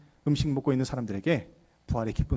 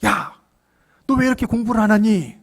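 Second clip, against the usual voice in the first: high, loud scolding; angry mother; 6 semitones higher, 5 dB louder than usual. Retold or imitated. Mother telling off her son for not studying, angrily. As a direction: scold your child in a raised, indignant voice. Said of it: "화를 내는 거예요".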